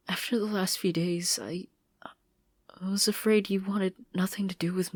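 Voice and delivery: hushed, tearful whisper. Acted. Marcy crying to Sasha, whispered. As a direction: admit the fear while crying.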